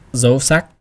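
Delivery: sắc rising in pitch. Shown as a demonstration. Teacher naming the Vietnamese tones, sắc rising. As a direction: say dâu sắc, lifting the pitch on sắc. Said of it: "rising tone".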